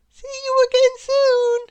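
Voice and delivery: very high, loud character voice; high-pitched sunny send-off; cheery farewell. A narrator's reading. Little Miss Sunshine bids farewell.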